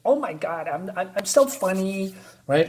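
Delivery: ordinary delivery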